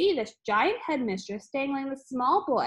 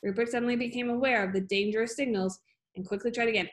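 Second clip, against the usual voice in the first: plain reading voice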